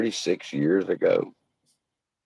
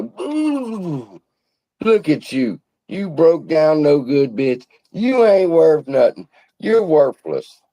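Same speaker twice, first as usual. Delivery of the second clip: mocking horses; 7 semitones higher, slower than usual. Horses jeering at the old donkey, mocking and high. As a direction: sneer and jeer at the broken-down donkey.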